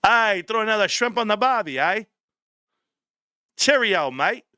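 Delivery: badly faked Aussie accent sliding into English; Australian-English accent. Shown as a demonstration. Streamer showing his few accents, mock Australian-English mix. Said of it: "Everything ends up being Australian and English mixed in".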